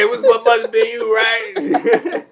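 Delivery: high, loud, hammed-up picking a fight; mock angry accusation. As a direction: shout it like a partner hunting for a reason to argue. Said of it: "when y'all mad as shit, look for a reason to talk".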